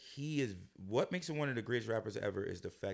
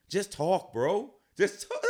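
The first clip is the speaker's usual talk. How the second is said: mocking demand; 6 semitones higher, 6 dB louder, much slower than usual. Mocking [name], telling him to talk.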